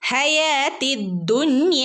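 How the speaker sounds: ദുന്യ with a full, heavy d; heavy dal. Shown as a demonstration. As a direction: recite ദുന്യ thickening the d. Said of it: "ഒന്ന് കനപ്പിച്ച് ഓതി കൊടുക്കുക". (ദുന്യ കനപ്പിച്ച് ഓതുക)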